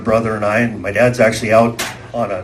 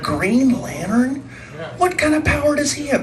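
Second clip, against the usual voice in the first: incredulous question; 11 semitones higher, 6 dB quieter than usual. Retold or imitated. Incredulous reply in retold argument.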